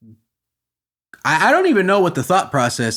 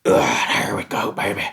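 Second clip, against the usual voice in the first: mock country sheriff; 10 semitones lower than usual. Mocking the sheriff in a lazy country accent. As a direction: talk like a boozy country sheriff. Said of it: "let me distend my belly".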